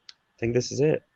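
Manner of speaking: softly voiced thought to oneself; hushed recalled realization; quiet realization. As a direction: say it small and soft, as it dawns. Retelling his quiet realization.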